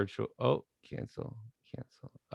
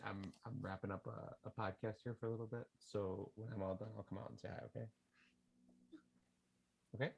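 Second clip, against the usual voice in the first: quiet aside; 17 dB quieter, much faster than usual. Real, not acted. Father softly asking his daughter to wait, hushed.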